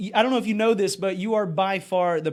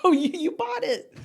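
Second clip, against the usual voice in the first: mock protest; 9 semitones higher, slower than usual. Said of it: "and I'm like".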